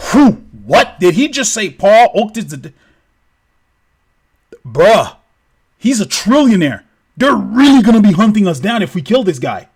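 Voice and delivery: hammed-up astonishment; acted shock. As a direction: react in alarmed disbelief. Characters react stunned to the name.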